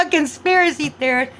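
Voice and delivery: loud, high mock laughter into a jeer; mocking scoffers. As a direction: hoot with laughter, then sneer the label. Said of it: "you got people".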